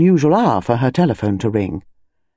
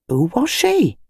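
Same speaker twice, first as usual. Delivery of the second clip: much higher woman's voice; curious wife; 7 semitones higher, slower than usual. Acted. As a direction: call it from the kitchen.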